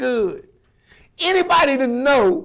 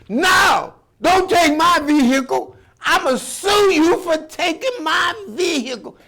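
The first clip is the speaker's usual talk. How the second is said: protesting shout; 6 semitones higher than usual. Acted. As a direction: yell like a spoiled kid losing his toy. Boy voiced losing stolen car.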